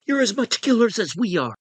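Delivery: put-on high, strained drunken sneer; high-pitched contemptuous jab; Roy's disgust. A narrator's reading. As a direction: spit the accusation out high with disgust. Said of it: "looked at them with disgust".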